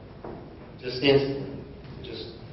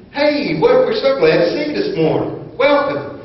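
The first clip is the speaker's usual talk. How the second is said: eager welcome; 10 semitones higher, 4 dB louder than usual. Retold or imitated. Voicing congregants greeting him.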